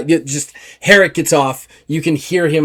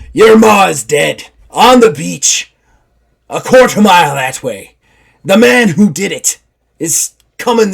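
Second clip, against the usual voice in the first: louder, higher rough pirate, pressing; 4 semitones higher, 6 dB louder than usual. Acted. Simon Grimes confronting the soldier about his loyalty, in pirate voice. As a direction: challenge him hard. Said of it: "Simon just goes".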